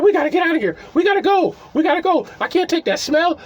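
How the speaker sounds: high-pitched imitation of a panicking girl; mock panicked fussing. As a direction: retell her frantic urge to flee. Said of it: "she was screaming. She was making a fuss".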